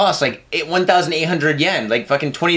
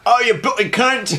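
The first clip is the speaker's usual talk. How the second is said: high, drawn-out comic British cursing; slow mock-British swearing with a laugh; mock British accent; 5 semitones higher, slower than usual. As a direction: ham up a British insult, half laughing. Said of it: "English and Scottish listeners".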